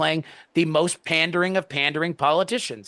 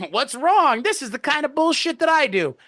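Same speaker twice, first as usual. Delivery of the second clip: clueless politician; 10 semitones higher than usual. Retold or imitated. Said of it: "are looking at this going".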